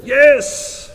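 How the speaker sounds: loud, high eager yes; eager shout. Retold or imitated. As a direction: shout it out keenly. Churches' eager reply, voiced.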